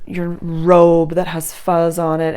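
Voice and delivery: normal talking voice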